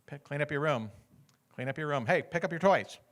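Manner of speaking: normal talking voice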